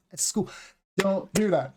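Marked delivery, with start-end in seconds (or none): 0.1-0.5 "school" with an E before it, eh-school; Spanish-speaker mistake. Shown as a demonstration. Teacher showing a learner error, eh-school pronunciation. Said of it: "for pronounce S and E, like".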